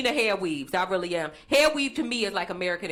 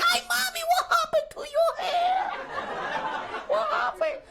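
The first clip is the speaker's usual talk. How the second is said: Dominican guy. Retold or imitated. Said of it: "He's like".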